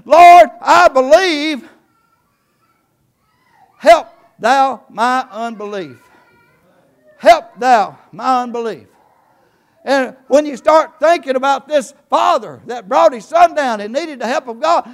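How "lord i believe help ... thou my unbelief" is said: drawn-out booming scripture reading; solemn pulpit proclamation, slow and loud; slow loud preaching. Acted. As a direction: proclaim the verse slowly from the pulpit.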